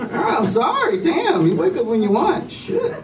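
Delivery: high, quick retreat; backing off fast, high-pitched; scared sheepish dad. Retold or imitated. Dad backing off from angry toddler.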